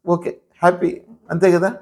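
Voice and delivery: usual speaking voice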